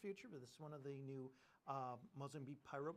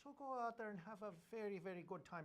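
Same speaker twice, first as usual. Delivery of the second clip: put-on Irish accent; 3 semitones higher than usual. Host prompts him about Saint Patty's Day, playful Irish accent. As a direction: put on a lilting Irish brogue. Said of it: "go in the Irish accent again".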